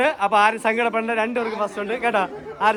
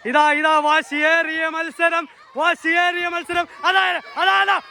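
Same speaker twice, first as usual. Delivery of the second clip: lively play-by-play, pitched higher; sports commentary; 6 semitones higher than usual. Acted. Commentating the kids' lemon-and-spoon race, lively play-by-play.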